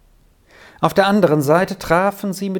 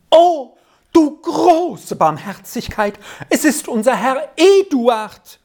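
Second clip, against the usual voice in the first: high, stretched exclamation of joyful shock; astonished scream; 10 semitones higher, 4 dB louder than usual. A narrator's reading. Old Trine recognising Herr Eduard, screaming out in amazement. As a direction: cry out far higher, drawing it out. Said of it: "schrie sie noch lauter auf".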